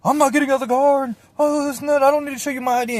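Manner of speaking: high, loud, rapid-fire caricature; mocked defiant driver. Driver refusing police at a traffic stop, high mocking rant. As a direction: rattle off the refusal in a shrieky put-on voice. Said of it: "don't be one of those guys".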